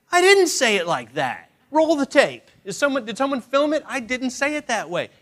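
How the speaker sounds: loud, high-pitched outraged denial; indignant protest. Acted. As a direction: protest your innocence, hammed up. Husband protesting inwardly in marital quarrel.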